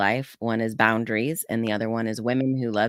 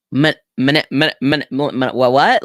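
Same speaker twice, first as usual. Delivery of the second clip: loud stammering stumble over the word manipulate; stammering dad; 9 dB louder, faster than usual. Retold or imitated. Dad caught out by daughter, loud baffled stammer. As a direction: trip over the word manipulate, louder and louder, then a baffled what. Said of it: "he's like".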